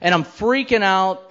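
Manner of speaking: ordinary talk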